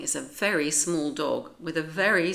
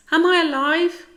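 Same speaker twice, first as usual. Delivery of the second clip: very high, louder tiny dog voice; dazed Fifi; 12 semitones higher, 11 dB louder than usual. A narrator's reading. Fifi lands after being dropped, high and amazed. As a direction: ask it in wide-eyed disbelief. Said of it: "Fifi says".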